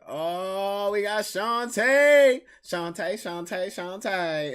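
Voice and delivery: rising, drawn-out hype greeting; excited shout-out. Real, not acted. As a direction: build from a long "oh" to a loud, high welcome. Greets a viewer arriving in chat.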